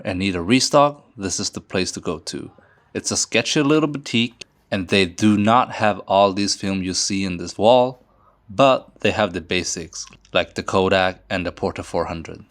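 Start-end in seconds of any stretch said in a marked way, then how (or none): none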